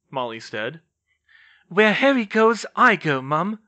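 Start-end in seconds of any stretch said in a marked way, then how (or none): 1.7-3.6 loud, pitched-up defiant retort; sharp, loud insistence; snapped. A narrator's reading. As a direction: snap back at your mother. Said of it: "Ginny snapped".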